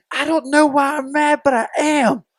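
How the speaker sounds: high, loud mock scream, slowed; retold blood-curdling scream. Girlfriend screaming at him in the driveway, high mock shriek. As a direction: shriek it like she's losing it. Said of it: "she just screamed in the driveway, this blood-curdling scream".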